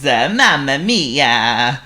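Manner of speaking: very slow, drawn-out Italian sigh; Italian accent. A narrator's reading. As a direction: groan it slowly in Italian style. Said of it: "added Giuseppe".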